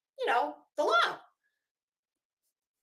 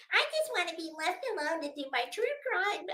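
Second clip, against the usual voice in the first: mocking imitation, a bit softer and fast; mock Betty; 4 semitones higher, 4 dB quieter, faster than usual. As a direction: parody her as she brushes you off. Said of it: "talks to me".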